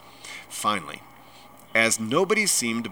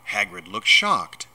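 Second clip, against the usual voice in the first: ordinary delivery, nothing marked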